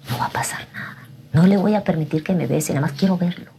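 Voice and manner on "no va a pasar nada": unvoiced self-reassuring whisper. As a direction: tell yourself in the mirror it's fine. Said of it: "me miré en el espejo y dije". (susurro ante el espejo)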